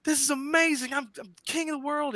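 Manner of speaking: very high, giddy crowing; ecstatic announcement. Acted. Justice telling the room he got the part, sky-high and giddy. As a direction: crow it like you just won everything.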